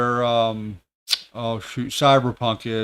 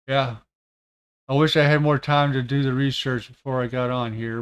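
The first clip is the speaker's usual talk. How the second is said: ordinary talk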